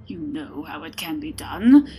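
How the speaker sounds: seething. Acted. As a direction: spit it out in contained rage. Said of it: "seethed the Winter Lord".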